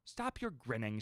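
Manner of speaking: plain reading voice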